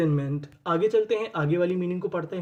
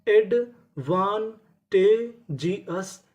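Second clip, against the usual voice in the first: Advantageous stretched very slowly, each syllable held; slow drawn-out reveal; much slower than usual. Shown as a demonstration. Teacher showing students the full word, very slow. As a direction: spell out advantageous slowly in sound. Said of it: "तो पूरी meaning".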